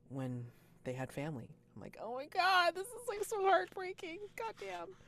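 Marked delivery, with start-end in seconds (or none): 2.0-5.0 very high, squeaky heartbroken reaction; anguished gushing. Retold or imitated. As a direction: wail it high, like an overwhelmed fan. Retold reaction to a heartbreaking reveal.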